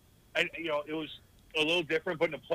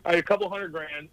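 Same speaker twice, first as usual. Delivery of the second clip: usual speaking voice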